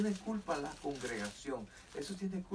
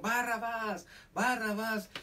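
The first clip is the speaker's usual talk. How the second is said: higher, imitated chant of a clamouring mob; retold crowd shout; 5 semitones higher, 3 dB louder, slower than usual. Crowd answering Pilate's choice of prisoner, imitated shouting. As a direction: call out the name like a crowd demanding it. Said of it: "todos gritaron".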